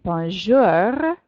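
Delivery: loud-ending "bonjour" with a long growled R; French pronunciation. Shown as a demonstration. Teacher models bonjour for students to try, exaggerated French R. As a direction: stretch "bonjour" and growl the final R. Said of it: "then try saying".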